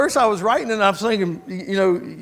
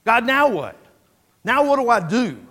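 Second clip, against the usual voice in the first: louder, urgent plea for direction; earnest asking; 3 semitones higher, 6 dB louder than usual. Acted. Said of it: "of really wanting to know".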